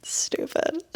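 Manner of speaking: ordinary talk, nothing marked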